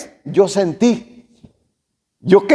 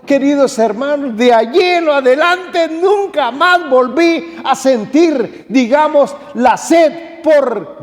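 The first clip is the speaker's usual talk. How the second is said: fervent preaching; 5 semitones higher than usual. Acted. Preaching with rising force.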